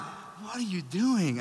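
slow, hushed bewilderment; baffled disbelief. Retold or imitated. Wife staring at her sleep-yelling husband, hushed disbelief. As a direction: drop to a slow, quiet question.

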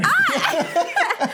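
high squeal of laughter; delighted laughing shriek. Real, not acted. Friend shrieks with laughter at the story's punchline.

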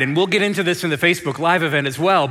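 usual speaking voice

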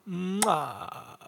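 drawn-out, badly faked Italian accent. Host praising smoky beer and sausage, bad Italian accent. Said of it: "I did a bad Italian accent".

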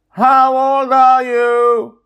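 very high, loud, slow call; 2 yelling again. Acted. Joy yelling the question at the dreamer, slow and loud. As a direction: stretch the shout out long. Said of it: "Joy repeats".

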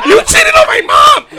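high, loud fake outrage; mock shout. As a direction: yell it like a wronged kid.

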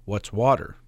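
ordinary talk

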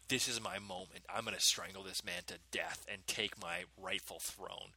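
scheming mutter. Retold or imitated. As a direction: plot it under your breath like a villain. Scheming inner voice.

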